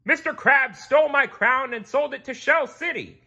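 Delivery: high, loud angry king; outraged royal yelling; hollered accusation. A narrator's reading. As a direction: shout the charge at full force. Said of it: "he hollered".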